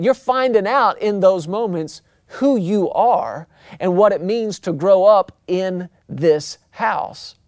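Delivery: ordinary talk, nothing marked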